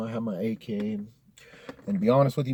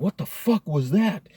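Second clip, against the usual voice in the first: higher, startled, a retold scared cry; frightened outburst; 8 semitones higher, 4 dB louder than usual. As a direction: imitate the panic of hearing something in the dark. After hearing the screech.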